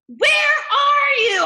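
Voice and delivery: loud, high, slow angry shout; booming shout. Shown as a demonstration. Angry father hunting the boys, booming shout. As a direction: boom it through the house. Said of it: "My father's voice was booming".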